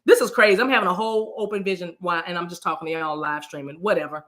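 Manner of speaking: ordinary talk, nothing marked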